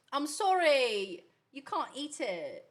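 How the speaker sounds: drawn-out put-on British, broad "can't"; British accent. Telling a pet it can't eat it, mock British accent. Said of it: "your British accent".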